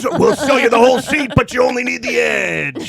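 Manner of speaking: monster truck announcer. Retold or imitated. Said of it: "remember the car crusher things".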